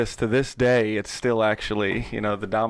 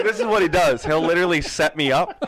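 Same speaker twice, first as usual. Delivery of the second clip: ordinary talk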